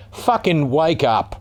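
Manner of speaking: "fucking wake up" drawn out very slowly, words punched; slow emphatic swearing. Real, not acted. Ranting vlogger telling society "fucking wake up", slow and hard. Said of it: "I'm trying not to swear".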